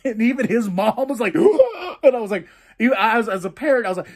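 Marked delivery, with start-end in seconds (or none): 1.4-2.0 imitated gasp. Mom reacting to the heel turn.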